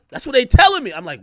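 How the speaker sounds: usual speaking voice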